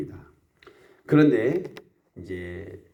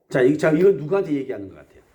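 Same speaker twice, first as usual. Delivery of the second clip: ordinary talk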